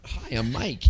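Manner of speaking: understated mock American accent, soft; softly echoed American-accent imitation; American accent impression. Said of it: "that's how we sound".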